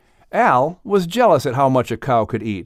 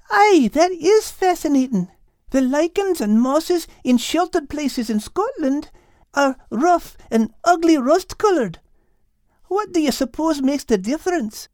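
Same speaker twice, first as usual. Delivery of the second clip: put-on high, eager girl voice; curious and keen to learn; eager young Kate; 14 semitones higher than usual. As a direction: Kate eagerly asking Liz about flowers. Kate compares Scotland.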